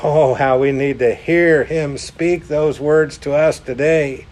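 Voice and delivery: usual speaking voice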